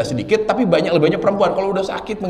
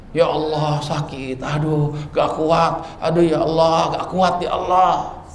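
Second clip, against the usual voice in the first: mild mock groaning; 3 semitones lower than usual. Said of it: "lalu kita mengerang kesakitan".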